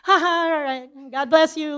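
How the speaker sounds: loud, high fake laugh and blessing; retold strained farewell; forced politeness. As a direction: cover annoyance with over-bright pleasantries. Forced goodbye after provoking remark.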